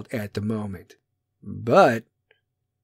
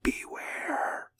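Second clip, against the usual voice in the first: spooky breathy whisper; eerie whispered beware; whispered warning; 12 dB quieter than usual. Pretend. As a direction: hiss it like a ghostly omen. Ominous whispered echo.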